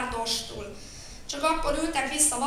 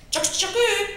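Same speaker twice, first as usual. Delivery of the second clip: much higher, loud shout; 11 semitones higher, 9 dB louder, slower than usual. Acted. Child shouts to blame the other, loud. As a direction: yell it out to accuse him. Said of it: "kiabált megint".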